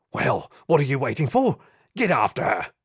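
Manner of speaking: loud, exasperated barked command; angry order. Acted. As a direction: chase them into action. Inspector barks at his men.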